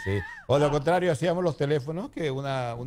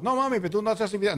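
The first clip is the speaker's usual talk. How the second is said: protesting daughter; 8 semitones higher, faster than usual. Retold or imitated. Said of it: "dice que dijo".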